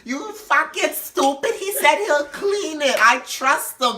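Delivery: put-on high, slow drunken insistence; mock tipsy woman arguing her case. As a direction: drag the words out, high and stubborn. Drunk Donette argues with coworker.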